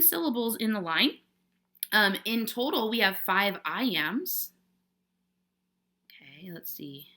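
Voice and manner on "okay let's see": barely audible whispered thinking aloud; very soft, absent-minded murmur; muttering to self. Real, not acted. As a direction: whisper it under your breath while working. Muttering to self while working.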